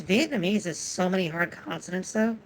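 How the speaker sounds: normal talking voice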